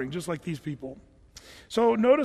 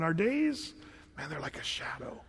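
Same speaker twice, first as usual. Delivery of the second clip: put-on older person musing; 6 dB quieter than usual. Preacher voicing older David on fleeting days, hushed musing. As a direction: imitate an ageing man's wistful realisation, trailing into whisper. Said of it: "Does that sound like an older person?".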